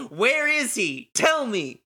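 high, drawn-out demand; insistent demand. Acted. Shoka demands to know where he is, insistent. Repeated demand escalates in acted scene.

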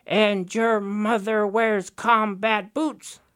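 mocking high Raven jeering; taunting Raven. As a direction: goad the salmon with insults. Raven taunts King Salmon.

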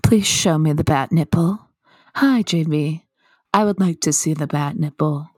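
low, measured put-on voice; put-on deadpan request. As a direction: drop it low and ask with mock solemnity. Put-on plea to see bat nipple.

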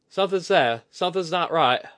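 high, loud warning; urgent alarm. Acted. Woman sensing danger warns her companion, alarmed.

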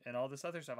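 ordinary talk

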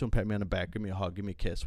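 ordinary delivery

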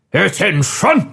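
put-on old army officer. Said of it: "old army type accent".

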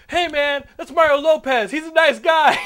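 high, loud indignant crowd voice; crowd scolding. Retold or imitated. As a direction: defend Mario, shocked.

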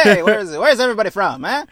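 mock stand-up opener; stand-up crowd work. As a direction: play the club comedian greeting the crowd. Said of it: "crowd work now?".

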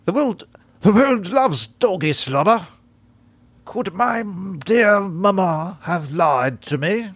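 high, slow hammy dog voice; badly faked British dog. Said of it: "Can I try the dog voice?".

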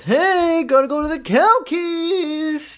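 high, drawn-out mock holler; sing-song shout, held long; comic call-out. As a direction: call it out high and stretch the last word.

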